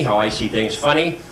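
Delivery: ordinary talk, nothing marked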